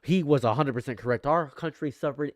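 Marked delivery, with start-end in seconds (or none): none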